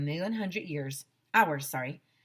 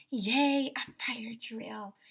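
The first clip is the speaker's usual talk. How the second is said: cheering; 5 semitones higher, much slower than usual. Acted. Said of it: "Everybody started shouting".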